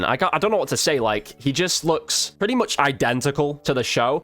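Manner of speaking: normal talking voice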